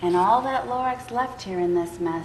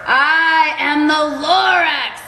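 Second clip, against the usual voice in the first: slow, loud proclamation; a small creature booming its name; booming Lorax; 6 semitones higher, 8 dB louder, slower than usual. Acted. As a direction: announce yourself slowly, loud and proud. Lorax announces himself angrily.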